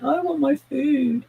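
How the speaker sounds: the cat begging for food, high and sweet; cat's inner voice. Retold or imitated. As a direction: voice the greedy cat, high and pleading. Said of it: "He's like".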